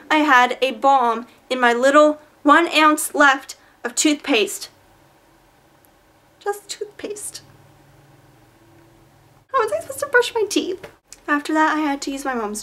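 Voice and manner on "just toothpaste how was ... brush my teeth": high, whining complaint; squeaky put-upon whine; whiny disbelief. Real, not acted. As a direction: plead up high in mock outrage. Whining about lost toothpaste.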